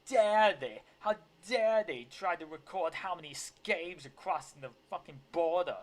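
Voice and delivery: loud, high theatrical tirade; video impression of outrage. Commentator parodying outraged politician about the border, mock indignation. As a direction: rant with hammed-up indignation, leaning hard on DARE. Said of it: "I'm gonna say it like that humongous video".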